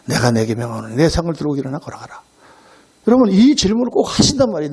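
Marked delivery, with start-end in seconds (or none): 0.1-2.2 low, measured voice of authority; lightly voiced Jesus, pitched low; solemn command. Jesus commands the sick man to walk, solemn and low.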